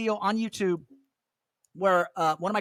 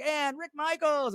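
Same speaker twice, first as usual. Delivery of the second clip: slow, drawn-out announcer call; a hint of a booming emcee; mock announcer intro; 7 semitones higher, slower than usual. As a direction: ham up the stage introduction.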